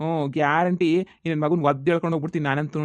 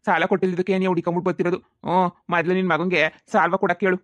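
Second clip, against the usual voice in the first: ordinary talk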